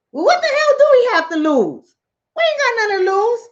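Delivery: high-pitched mock sheep talking it over; acted high voice of easily swayed sheep; persuaded sheep. Poor sheep echo the wolf.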